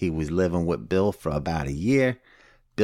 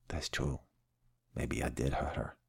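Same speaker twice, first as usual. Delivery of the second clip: much softer, lower, conceding quietly; sheepish admission; 6 semitones lower, 13 dB quieter than usual. Retold or imitated. Alcoholic finally owning up to the sponsor, quiet and sheepish. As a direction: let the fight drain out as he admits it.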